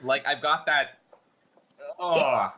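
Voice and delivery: queasy, near retching; gagging. Real, not acted. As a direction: talk while fighting nausea. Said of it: "my eyes are watering".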